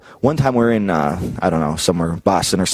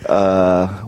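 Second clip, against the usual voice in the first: ordinary delivery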